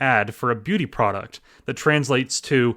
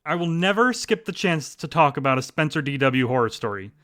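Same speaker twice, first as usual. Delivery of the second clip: ordinary talk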